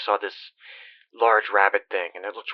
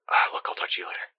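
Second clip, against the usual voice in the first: hushed whisper; 5 dB quieter than usual. Acted. Caller hiding from animatronic, urgent whisper. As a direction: drop to a quick whisper, someone's near.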